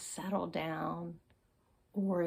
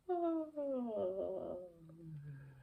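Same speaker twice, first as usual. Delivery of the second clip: long sigh. Shown as a demonstration. Calming demo.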